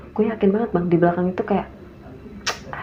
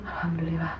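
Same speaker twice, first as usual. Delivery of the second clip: soft relieved thanks; 5 semitones lower, 12 dB quieter than usual. Retold or imitated. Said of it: "Tante gue ngomong gitu".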